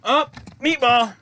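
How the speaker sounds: sudden loud, high call-out; surprised exclamation. Real, not acted. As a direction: break off and exclaim at Meatball. Cat suddenly interrupts the recording.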